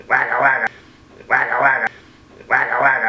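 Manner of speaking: playful vocal engine putter; motorcycle engine noise. Retold or imitated. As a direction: imitate a loud bike revving through the crowd. Imitating his loud bike pipe.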